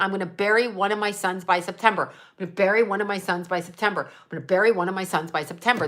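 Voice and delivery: rapid, run-on repeated phrase; fast, automatic re-enacted refrain; compulsive repetition. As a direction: rattle it off on a loop. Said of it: "That's how it works for me".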